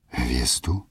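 a hushed, questioning whisper; whispered, rising disbelief; stunned whisper. Acted. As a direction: breathe the word out in disbelief. Ondřej stunned by impossible request.

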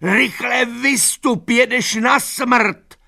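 very high, loud, drawn-out pleading; desperate screaming. A narrator's reading. Mare warns Boxer of death, desperate screaming. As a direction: scream a life-or-death warning. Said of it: "křičela zoufale Lupina".

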